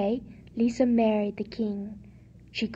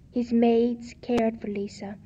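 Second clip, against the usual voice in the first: ordinary delivery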